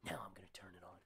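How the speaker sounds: low, soft, unvoiced whisper; hushed, knowing whispered line; sly whisper. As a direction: breathe it like a showman's secret cue. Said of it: "And then he goes".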